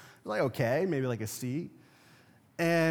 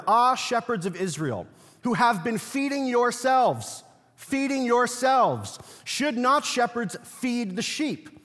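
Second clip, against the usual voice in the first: raised, slowed reading that leans on "feeding yourselves"; preached scripture reading; 4 semitones higher than usual. Acted. Preacher reading Ezekiel to his congregation, raised and forceful. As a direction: proclaim the prophet's words with rising force. Reads Ezekiel aloud in sermon.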